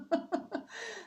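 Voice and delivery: high-pitched real laugh. Real, not acted. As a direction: burst out in high, helpless giggles. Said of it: "I don't know why I'm laughing".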